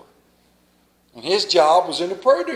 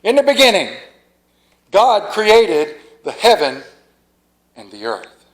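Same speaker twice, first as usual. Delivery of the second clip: scripture reading; 3 semitones lower than usual. Acted. Preacher reading Genesis to the congregation, solemn. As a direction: proclaim the opening of Genesis.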